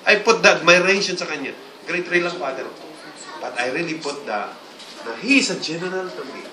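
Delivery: ordinary delivery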